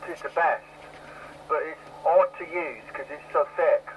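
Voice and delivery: ordinary talk, nothing marked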